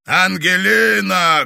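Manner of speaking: loud, slow rumbling call; booming roar. Acted. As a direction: roar her name, booming. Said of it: "гулко пророкотал".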